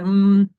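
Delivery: loud closed-lip hum, demoing the gesture; humming M. Shown as a demonstration. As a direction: hum mm firmly with lips shut. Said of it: "Tengo mucha costumbre de hacer". (zumbido mmm fuerte para mostrar la M)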